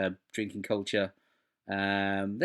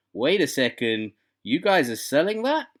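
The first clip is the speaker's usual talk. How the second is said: put-on New York accent; 6 semitones higher, 7 dB louder than usual. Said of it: "which I'm guessing is in a, in a New York accent".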